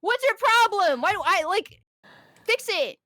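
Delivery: acted outburst at a customer; exasperated shout. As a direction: let loose in mock fury.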